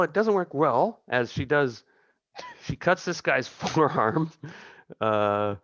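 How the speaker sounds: ordinary delivery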